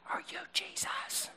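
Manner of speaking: timid unvoiced whisper. Guards asking Jesus again, fearful whisper. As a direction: ask it in a scared whisper. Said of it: "Maybe they were like".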